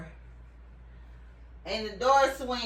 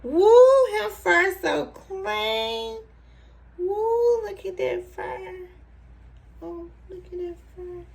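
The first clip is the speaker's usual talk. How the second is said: very high, drawn-out pet voice; cooing admiration; 9 semitones higher, slower than usual. Real, not acted. Owner admires freshly cleaned fur, high cooing pet talk. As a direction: squeal a woo, then coo high.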